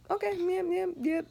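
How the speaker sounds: high, soft little yeses; meek compliance. Retold or imitated. Daughter answers mom's chore orders, high meek agreement.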